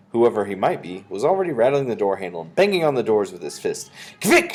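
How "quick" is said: sudden loud, high yell; witch's shout. A narrator's reading. Grand High Witch hurries the witches, shouting. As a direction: shout an urgent order as a witch. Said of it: "shouted the Grand High Witch".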